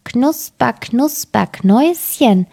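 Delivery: a hint of a thin witch voice; lightly voiced witch calling out; fine little voice. A narrator's reading. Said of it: "rief eine feine Stimme".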